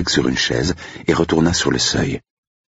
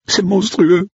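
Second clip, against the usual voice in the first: high, louder, gulping voice; hiccuping sob; 4 dB louder, slower than usual. Acted. Cook horrified by the headless mouse, high hiccuping sob. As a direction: gulp it out between sobs. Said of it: "hoqueta-t-elle".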